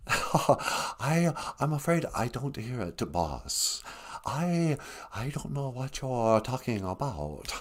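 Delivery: soft, slow mock Chinese accent for the puppet; lightly put-on Chinese accent, quiet. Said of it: "that's kind of a stereotypical accent".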